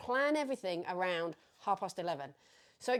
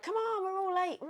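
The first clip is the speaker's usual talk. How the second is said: frustrated hurry-up call; 11 semitones higher than usual. Retold or imitated. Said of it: "I'm like".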